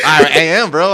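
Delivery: insisting through a loud high laugh. Real, not acted.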